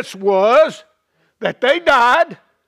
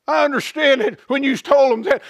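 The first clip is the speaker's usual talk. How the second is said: ordinary talk, nothing marked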